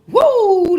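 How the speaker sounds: high, loud celebratory woo; real delighted shout; excited whoop. Real, not acted. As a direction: let out a big joyful whoop. Thrilled by the cards' message.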